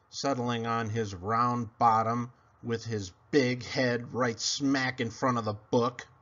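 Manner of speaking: plain reading voice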